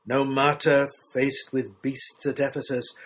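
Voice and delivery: ordinary delivery, nothing marked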